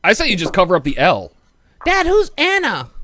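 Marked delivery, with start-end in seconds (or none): 1.9-2.9 put-on high, slow little kid asking; squeaky child's question.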